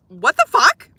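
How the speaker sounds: high, loud incredulous yelp; exasperated outburst. Real, not acted. Streamer to chat about the smelly van, loud disbelieving outburst. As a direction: blurt it out in disbelief.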